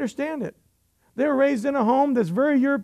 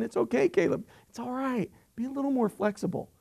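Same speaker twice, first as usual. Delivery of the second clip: soothing son; 2 semitones higher, 6 dB quieter than usual. Retold or imitated. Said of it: "I'm always having to tell my son".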